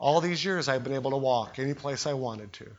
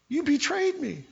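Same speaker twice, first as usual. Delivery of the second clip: mock betrayed; 11 semitones higher than usual. Pastor's mock reply.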